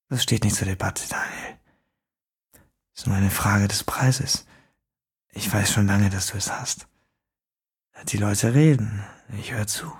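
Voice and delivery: leise, raunende Fremdenstimme; düster und gedämpft aus dem Schatten; unheimlicher Fremder. A narrator's reading. Fremder spricht. (geheimnisvoller Fremder im Dunkeln, leise raunend)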